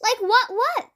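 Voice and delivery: high, puzzled question with echo; innocent, not noticing the echo; twin's echo talk. Acted. Twins answer Mister Nosy's question, high and puzzled. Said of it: "do you always talk like this".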